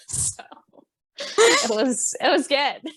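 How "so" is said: unvoiced, barely there; whispered. Real, not acted. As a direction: breathe the word out in a whisper. Whispered trailing word.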